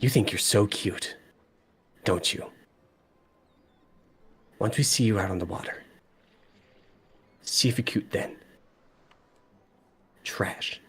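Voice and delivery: put-on quiet, contemptuous Dragonborn in the intended accent; hushed disdain in the character's accent, trailing to unvoiced; corrected Dragonborn accent. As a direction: redo the accent as a soft threat. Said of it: "sorry, not that accent".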